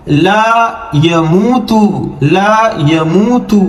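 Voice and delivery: slow, syllable by syllable; slow recitation. Shown as a demonstration. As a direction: read لا یموتو slowly. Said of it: "slow model recitation". (آہستہ تلاوت)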